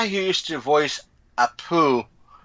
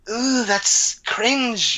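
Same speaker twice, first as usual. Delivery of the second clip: mock cringe reaction; 7 semitones higher, much slower than usual. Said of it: "it's just like".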